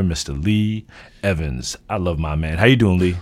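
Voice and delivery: usual speaking voice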